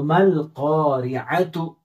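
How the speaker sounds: careful teacher's read of मल क़ारिअतु; slow Quranic reading. Shown as a demonstration. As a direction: recite मल क़ारिअतु slowly, मीम joined onto लाम. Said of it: "रा को बारीक पढ़ेंगे".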